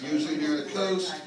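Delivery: ordinary delivery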